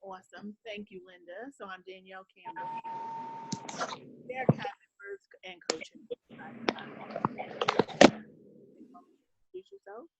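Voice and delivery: muffled, distant bad-connection sound; like talking from underwater; underwater audio. Real, not acted. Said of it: "you sound like you're underwater".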